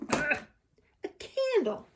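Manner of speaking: high, loud delighted exclamation; excited reveal. Real, not acted. Revealing the first calendar gift, excited. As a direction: pitch it way up in delight.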